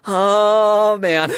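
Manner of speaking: loud, drawn-out mock groan; anxious loner. Game master imitating lone cosplayers, loud mock groan. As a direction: groan it out, imitating a nervous contestant. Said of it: "people, like, sitting there alone, like, doing the".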